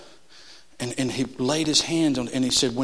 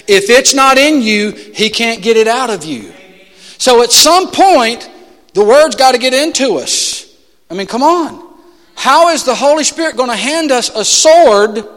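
preaching at full force; 7 semitones higher, 10 dB louder than usual. Acted. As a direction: hammer every phrase home to the church. Preaching to congregation.

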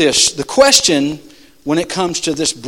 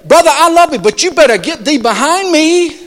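polite refusal; 8 semitones higher, 4 dB louder than usual. Retold or imitated. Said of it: "you say".

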